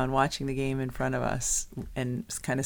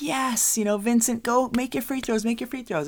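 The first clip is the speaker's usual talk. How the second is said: mock cheer to the player; 10 semitones higher than usual. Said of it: "I'm like".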